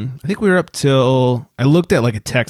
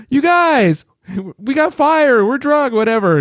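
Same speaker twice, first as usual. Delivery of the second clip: big gasp, then high-pitched excited squeal; mock drunk excitement; 12 semitones higher, slower than usual. Friend by a desert fire answers the call, mock excited.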